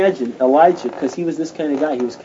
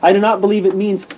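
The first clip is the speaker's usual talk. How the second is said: normal talking voice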